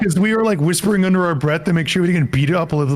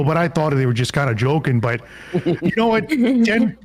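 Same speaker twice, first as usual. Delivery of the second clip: higher Wisconsin janitor voice; Wisconsin accent; 3 semitones higher than usual. Pretend. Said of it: "you doing that voice".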